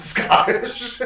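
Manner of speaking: breaking up into laughter on the word; laughing. Real, not acted. Host realizing the accent was probably Russian, breaking into laughter. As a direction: giggle helplessly as the word comes out.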